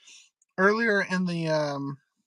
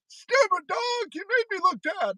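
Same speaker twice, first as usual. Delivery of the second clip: grumpy cartoon farmer; 10 semitones higher than usual. Cartoon line voiced.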